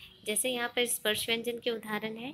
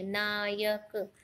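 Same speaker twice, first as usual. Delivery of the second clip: slowly sounding out; 3 dB quieter, much slower than usual. Shown as a demonstration. Teacher to students counting sounds, stretching Nayak very slowly. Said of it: "नायक शब्द में कितनी ध्वनियाँ हैं?".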